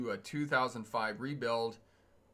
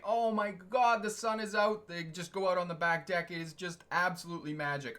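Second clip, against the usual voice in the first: excited; 6 semitones higher than usual. Real, not acted. Delighted about the sunny weather.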